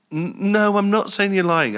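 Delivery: mock placating. Acted bar dispute, placating the customer.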